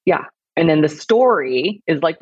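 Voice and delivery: normal talking voice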